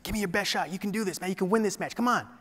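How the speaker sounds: soft but high, insistent pep talk; hushed urging. Retold or imitated. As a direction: imitate a teammate quietly egging him on. Teammate urging him on quietly.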